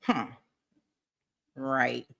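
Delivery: usual speaking voice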